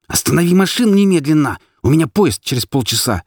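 high, furious demand; seething, urgent outburst; choking with anger. A narrator's reading. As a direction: demand it angrily, pitched high. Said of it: "прошипела, захлёбываясь собственной злостью".

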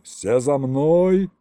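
drawn-out rallying call; slow, rising commander's shout; yelled order. A narrator's reading. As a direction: lead the charge with a long cry. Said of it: "заорал Колымдай".

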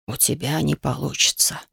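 put-on low, soft old sage; a quiet, beaten old man. Frightened sage speaks.

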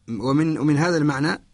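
usual speaking voice

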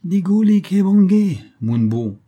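high loud cheeky call from above; Moorish girl calling. A narrator's reading. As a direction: shout down to him, brazen. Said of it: "cria la Mauresque".

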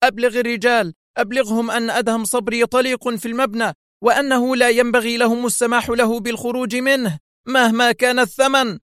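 high, urgent command; shouted order. A narrator's reading. Boss telling Carlo to alert the men, shouting. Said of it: "صائحة".

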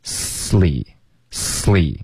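sly as "slee", long hissed s straight into lee; slow model of sly as one syllable, s-lee; linked consonants. Shown as a demonstration. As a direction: draw out the s of sly, no vowel before lee. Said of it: "跟力要连发".

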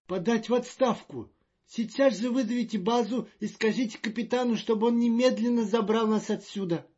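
high, loud and fretful; a jittery official in a panic; nervous diplomat voice. Acted. Magnan demands retreat.

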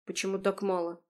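ordinary delivery

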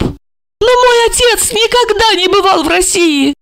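high, anxious pleading voice; bewildered older woman. A narrator's reading. Olga pleads with Marina, high and bewildered. Olga's voice.

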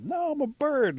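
high, loud insistence; indignant protest. Acted. Bat insisting to doubting birds that he belongs, indignant. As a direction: protest it, pitch jumping way up.

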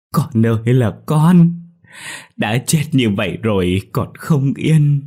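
loud, slow grieving cry; anguished wail. Acted. Mother protesting the autopsy, loud anguished wail. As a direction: wail out the pain. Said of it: "đau lòng để gào lên".

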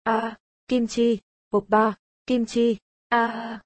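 slow, drawn-out female moans; climactic fake moaning. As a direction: moan in a put-on woman's voice. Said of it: "tiếng rên cũng dồn dập cao trào".

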